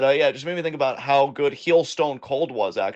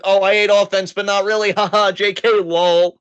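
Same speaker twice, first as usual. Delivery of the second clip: mock joking; 5 semitones higher, 5 dB louder than usual. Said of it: "It's like".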